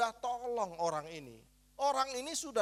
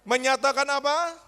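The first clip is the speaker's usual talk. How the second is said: calling out; 7 semitones higher, 6 dB louder than usual. Real, not acted. Preacher calls congregation to answer.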